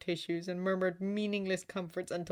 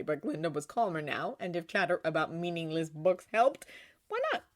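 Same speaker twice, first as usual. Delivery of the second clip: ordinary delivery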